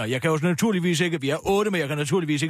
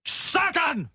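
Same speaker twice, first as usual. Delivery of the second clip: high-pitched triumphant exclaiming; elated cursing after pulling off a deal; exultant; 7 semitones higher than usual. Real, not acted. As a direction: crow over a political win, pitch soaring. Gloating after winning the vote.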